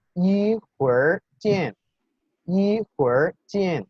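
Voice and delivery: 一会儿见 slowly, syllable by syllable, with an r-coloured 会儿; model pronunciation. Shown as a demonstration. Teacher introducing the expression to students, slow careful Mandarin. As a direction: say 一会儿见 high and slow for learners. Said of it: "y se dice".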